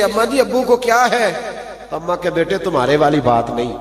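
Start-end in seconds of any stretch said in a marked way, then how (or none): 0.0-1.3 put-on higher-pitched anxious child; child asks his mother about his crying father, pitched high; child asking. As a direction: ask your mother like a worried little boy. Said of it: "بچے پوچھے".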